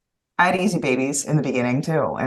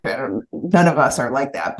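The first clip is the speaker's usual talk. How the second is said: ordinary talk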